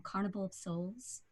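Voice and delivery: ordinary talk, nothing marked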